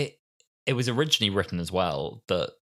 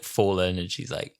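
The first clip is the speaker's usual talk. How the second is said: ordinary talk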